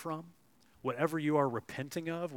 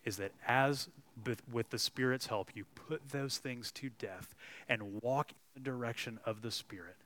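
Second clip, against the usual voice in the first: normal talking voice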